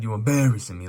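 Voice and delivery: put-on Italian accent. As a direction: say it like a hammed-up Italian tough guy. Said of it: "like an Italian".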